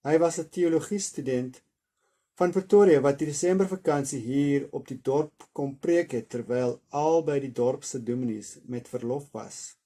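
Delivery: steady, expressive reading of an Afrikaans novel; story narration. A narrator's reading. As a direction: read the chapter aloud like a storyteller. Reads Afrikaans novel aloud.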